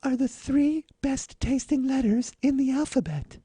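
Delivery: high, slow breathy starlet; Marilyn voice. Acted. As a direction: coo the joke like a starlet. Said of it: "Marilyn Monroe's voice".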